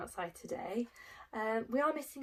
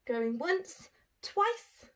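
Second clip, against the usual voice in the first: playful auction call; 6 semitones higher, much slower than usual. Acted.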